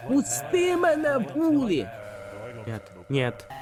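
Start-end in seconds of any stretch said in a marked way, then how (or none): 0.1-1.9 squealing plea to the bully; high desperate plea. Real, not acted. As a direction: let me go, much higher and stretched. Pleading while grabbed in game.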